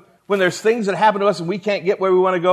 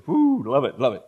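low delighted woo, a hint of an old man; lightly voiced Moses loving it; excited Moses; 5 semitones lower than usual. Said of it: "Moses says".

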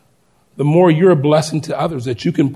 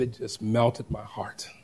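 much softer, lower, melting; a heart softened; tender, moved; 5 semitones lower, 14 dB quieter than usual. Retold or imitated. Father recalling his son's joy, soft and tender.

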